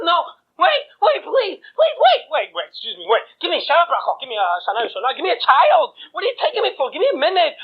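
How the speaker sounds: high, panicky begging; desperate groom pleading for his life; frantic pleading. Acted. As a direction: beg for time, voice climbing. Groom begs the angel of death.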